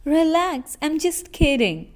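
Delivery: light, lively just-teasing line; quick reassurance. Acted. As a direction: hurry to take the sting out of a joke. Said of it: "so you quickly add".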